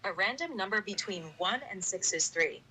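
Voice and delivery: flat synthetic voice; robot voice. Real, not acted. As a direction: computer text-to-speech. Dice bot voice answers.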